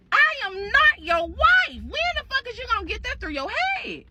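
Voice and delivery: high-pitched indignant yell, acted out; acted angry outburst. Wife telling him she is not his wife, indignant. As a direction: voice jumps up in exasperated protest. Retold wife snapping at husband.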